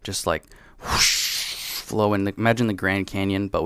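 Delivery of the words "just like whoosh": whooshing sound. Retold or imitated. Whooshing sound effect description.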